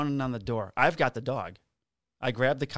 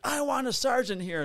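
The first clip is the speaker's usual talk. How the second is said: high-pitched angry woman imitation; retold indignant demand; 12 semitones higher than usual. Dog owner demanding a sergeant, high indignant imitation.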